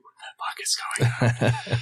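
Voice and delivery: whispered disbelief; stunned whisper. Retold or imitated. Told to stay backstage, whispered amazement. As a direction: mouth it in a hushed whisper. Guitarist asks them to stay.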